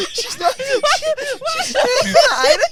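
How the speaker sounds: high, slow, squealing with laughter; real wheezing laughter through the words; helpless laughter. Real, not acted. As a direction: barely get it out for laughing. Cracking up at own accent.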